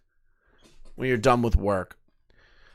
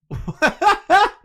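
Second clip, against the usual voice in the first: high burst of laughter; 6 dB louder than usual. Real, not acted. Laughs at her own slip.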